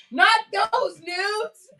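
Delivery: high, slow, drawn-out correction; exasperated. Retold or imitated. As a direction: correct her like a fed-up teen. Daughter correcting mishearing.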